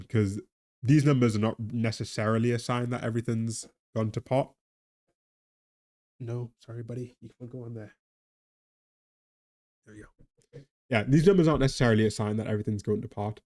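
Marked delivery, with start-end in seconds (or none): 6.2-10.2 gently murmured to a pet, much softer; quiet, kind words to an animal nearby; soft aside to pet. Real, not acted. Talking softly to a pet.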